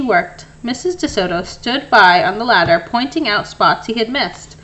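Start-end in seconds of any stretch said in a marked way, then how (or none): none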